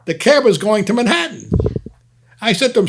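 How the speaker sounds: usual speaking voice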